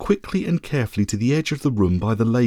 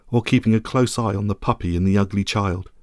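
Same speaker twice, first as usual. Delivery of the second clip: usual speaking voice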